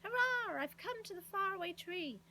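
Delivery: high, loud cheer; delighted shout. A narrator's reading. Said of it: "shouting in delight".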